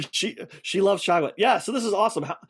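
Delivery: usual speaking voice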